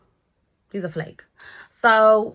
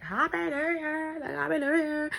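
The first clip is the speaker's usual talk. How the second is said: mimicking neighbours; 10 semitones higher, 4 dB quieter than usual. Neighbours shouting at countdown.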